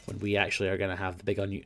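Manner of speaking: normal talking voice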